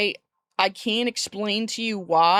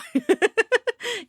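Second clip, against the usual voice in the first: high laugh; 4 dB louder than usual. Real, not acted. Reacting to viewer message.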